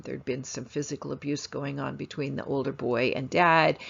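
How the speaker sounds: normal talking voice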